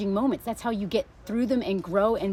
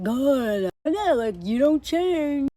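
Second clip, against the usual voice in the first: mock husband voice; 4 semitones higher, 4 dB louder, much slower than usual. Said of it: "he's just like".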